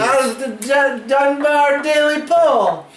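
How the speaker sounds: high nasal whine, drawn out slowly; Dunbar's nasally voice. Retold or imitated. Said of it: "I could just hear Dunbar's nasally fucking voice".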